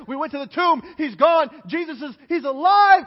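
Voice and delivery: breathless, rising excitement; excited women telling news. Acted. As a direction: blurt it out like thrilled women. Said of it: "They're so excited".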